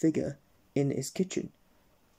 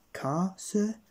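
hopefully; 6 semitones higher than usual. Acted. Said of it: "said the policeman hopefully".

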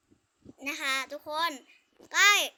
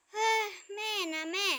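higher, tearful cry at mother; crying protest; 4 semitones higher than usual. Acted. Child wails at unfair mother, tearful cry. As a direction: wail at mother in tears.

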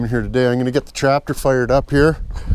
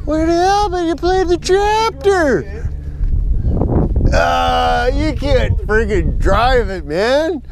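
much higher, slow silly character voice; goofy high voice; 10 semitones higher, much slower than usual. Pretend. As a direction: talk to the dog in a cartoon falsetto. Goofy voice at dog.

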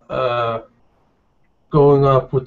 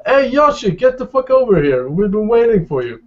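much higher and louder, bursting with excitement; excited greeting; 9 semitones higher, 5 dB louder than usual. Acted. Javier hails Joshua across the bar, thrilled and loud. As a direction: jump up and hail your buddy across the room. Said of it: "kinda jump out of his seat and go".

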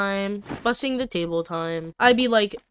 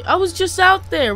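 loud, high outburst; exasperated protest; 11 semitones higher, 9 dB louder than usual. Real, not acted. Player told somebody's out there now, exasperated protest. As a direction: protest in disbelief, voice leaping up. Protest at news someone is outside.

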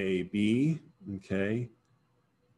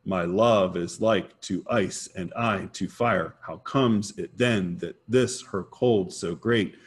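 sing-song iambic beat, punching "love", "like", "ice", "I", "fire"; stress on every second syllable. Shown as a demonstration. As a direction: hammer the second of each pair, "my LOVE is LIKE to ICE". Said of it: "putting the emphasis on the syllable that's in the second position".

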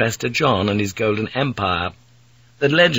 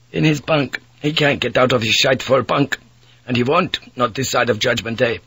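put-on a weary young Dutch sailor pleading; Dutch mate; 3 semitones higher than usual. Mate complains about first mate.

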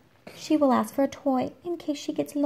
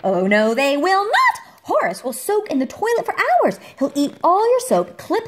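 higher and louder, building glee; excited exclaim; 8 semitones higher, 5 dB louder than usual. A narrator's reading. Reading to a child, gleefully listing the boars' mischief. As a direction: burst out that they won't behave. Emphatic denial, rising excitement.

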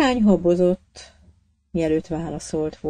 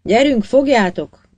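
louder and higher, barked command; shouted order; 6 semitones higher, 9 dB louder than usual. A narrator's reading. Boatswain shouting at sailors to seize the man. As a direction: bellow it across the deck. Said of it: "ordította a fedélzetmester".

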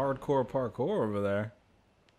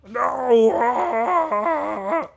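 loud panicked scream; screaming while falling; 12 semitones higher, 15 dB louder than usual. Real, not acted. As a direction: yell in sudden fright. Falls again, yelling.

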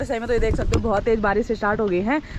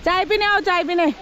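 calling out; 7 semitones higher, 3 dB louder than usual. Real, not acted. Calling family in for tea.

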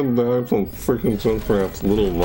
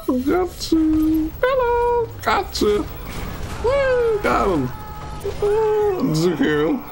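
much higher, shrieking and laughing mid-fight; frantic high screaming; much slower than usual. Real, not acted. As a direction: yell like the game is pure chaos.

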